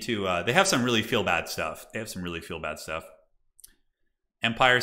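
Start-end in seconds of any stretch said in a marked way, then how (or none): 1.9-3.0 much softer, lower murmured echo; quiet aside. Real, not acted. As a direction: repeat it under your breath. Repeats the phrase as an aside.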